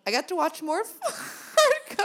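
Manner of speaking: high, slow Minnesota accent breaking into laughter; laughing Minnesota accent attempt. Shown as a demonstration. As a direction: attempt a Fargo-style Minnesota drawl. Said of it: "I can't do Minnesota".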